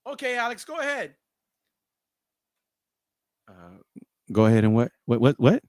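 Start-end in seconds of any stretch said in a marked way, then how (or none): 0.1-1.1 soft, high-pitched phone voice; small high voice down the line; manager on the phone. Retold or imitated. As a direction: prompt him softly in a high, thin register. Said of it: "I hear this".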